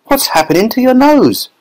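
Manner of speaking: very high alarmed cry; mother horrified at her child; shrieking mother. A narrator's reading. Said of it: "shrieked Missus Beauregard".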